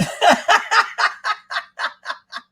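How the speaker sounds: loud, high cackle; long high-pitched laugh; real laughter. Real, not acted. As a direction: crack up at your own joke. Laughs at own joke.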